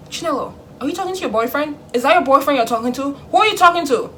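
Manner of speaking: high, loud, rapid-fire questioning; interrogating dad. Acted. Dad catching his daughter talking in her room, interrogating. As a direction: imitate a dad bursting in with accusations.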